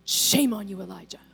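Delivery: low menacing whisper of judgment; stern judging God. Retold or imitated. A mock judging God whispering doom at Elijah, low and menacing. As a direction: hiss a threat of doom. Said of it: "God's like".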